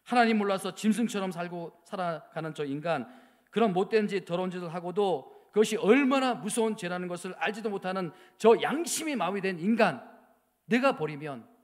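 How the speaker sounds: wife crying out over her husband's sin; anguished outcry. Retold or imitated. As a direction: cry out against the husband. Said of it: "막 울면서 그렇게 이야기하는데".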